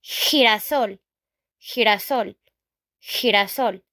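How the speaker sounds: "girasol" with a throaty h for G; Spanish pronunciation. Shown as a demonstration. Teacher modelling the Spanish for sunflower, "girasol" with throaty h. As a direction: say "girasol" the Spanish way, opening on a rough h. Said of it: "In Spanish".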